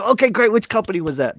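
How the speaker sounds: mock upbeat phone rep moving right along; chipper agent. Agent probing a caller for a company name, chipper.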